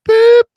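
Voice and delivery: loud, very high held tone; voicemail beep. Retold or imitated. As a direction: imitate an answering machine beep. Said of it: "all of a sudden you hear this".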